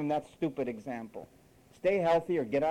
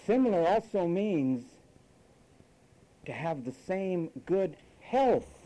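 ordinary talk, nothing marked

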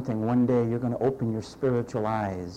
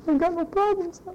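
mock carefree voice; 3 dB louder than usual.

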